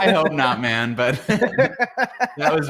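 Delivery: slow, embarrassed laugh through words. Real, not acted. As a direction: chuckle it out, a bit awkward. Embarrassed after the announcer bit.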